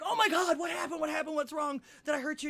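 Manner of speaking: high shouted volley of questions; hammed-up frantic alarm, loud at the start and high throughout; mock panic. Joke teller as man running back to her, high mock-panicked shouting. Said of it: "He goes, runs back".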